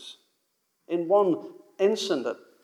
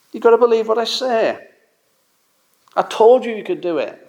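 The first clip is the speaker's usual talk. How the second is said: higher, insistent rebuke; exasperated; 6 semitones higher than usual. Retold or imitated. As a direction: press the point hard. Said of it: "you can imagine him saying".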